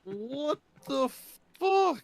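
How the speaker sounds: high rising shocked curse. Real, not acted. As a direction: explode in disbelief. Shocked at mascot name.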